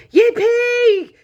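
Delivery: very high, loud whoop of delight; an old man whooping for joy; joyful shout. Acted. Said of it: "shouted Grandpa Joe".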